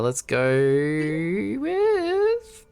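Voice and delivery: very slow, steadily rising pitch; long climbing drumroll of a delivery; drawn-out suspense. Real, not acted. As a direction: stretch each word upward before the name. Drawing out the pick of speaker.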